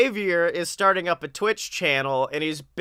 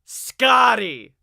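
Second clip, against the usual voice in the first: announcer; 4 semitones higher, 3 dB louder, much slower than usual. Acted. Host intro in announcer voice.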